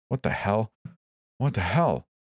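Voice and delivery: high, panicked disbelief; rising panic. A narrator's reading. Panic, numb.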